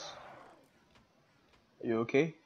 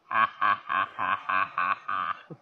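evil cackle; 4 semitones lower, 4 dB louder, slower than usual. Acted. Cackles at enemy in game.

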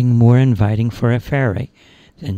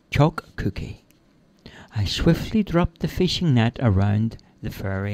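plain reading voice

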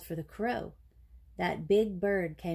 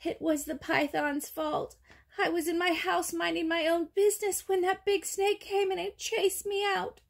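high little rabbit voice, protesting; timid rabbit, blaming; 10 semitones higher than usual. Acted. Timid rabbit blames the python before the king, high protesting voice. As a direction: explain yourself up high as a small scared creature.

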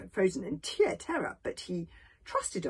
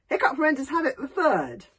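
higher, louder, mildly flustered reply; nervous stammer; 7 semitones higher, 9 dB louder than usual. A narrator's reading. Hiccup answering the stranger, mildly flustered stammer. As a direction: blurt out your full name. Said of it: "stammered Hiccup".

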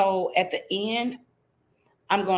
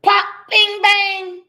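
much higher, louder pop bing bang; popping sounds; 11 semitones higher, 9 dB louder, slower than usual. Acted. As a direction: burst out each pop. Buttons popping sound effect.

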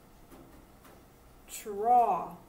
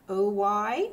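ordinary talk

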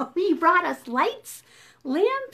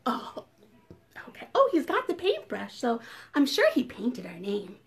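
plain reading voice

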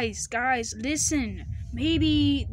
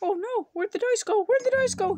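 panicked; 9 semitones higher than usual. Acted. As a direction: squeak out the alarm. Panic over lost dice.

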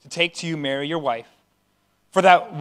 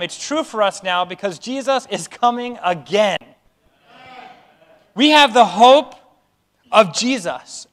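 high, loud, rising sermon climax; preaching at full force; 5 semitones higher, 4 dB louder than usual. Acted. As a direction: build the hope up to a preacher's peak.

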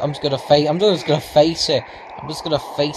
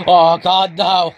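louder, dismayed outburst; alarmed cry; 3 semitones higher, 6 dB louder, slower than usual. Real, not acted. Player spotting a tornado in game, alarmed cry.

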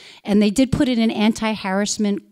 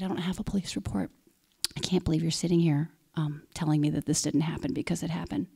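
quiet retold reply; 2 semitones lower, 7 dB quieter than usual. She quietly answers the board dad's demand for a report. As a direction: answer him quietly, stunned. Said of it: "And I said".

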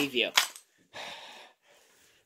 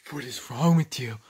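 breathless whispered question; whispering; 10 semitones lower, 6 dB quieter than usual. Real, not acted. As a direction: whisper it sharply.

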